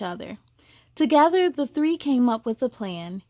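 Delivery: gentle storybook reading; storyteller voice for children; story narration. A narrator's reading. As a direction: read it like a bedtime story.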